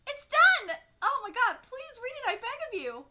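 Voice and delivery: a high, loud cry of triumph; eager writer begs her mentor to read the finished piece; excited exclaim. Acted. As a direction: burst out that it's finished.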